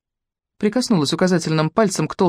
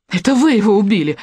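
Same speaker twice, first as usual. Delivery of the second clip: high, tense accusing shout; high strained cry; 5 semitones higher, 4 dB louder than usual. A narrator's reading. Polina accuses the woman of killing her brother, high strained shout. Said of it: "крикнула она высоким напряжённым голосом".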